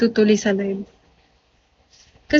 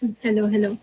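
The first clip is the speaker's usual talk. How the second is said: ordinary delivery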